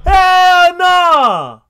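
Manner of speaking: loud, high refusal; shouted hell nah; disbelieving shout. Real, not acted. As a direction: yell it like you can't believe it. Reacting in disbelief.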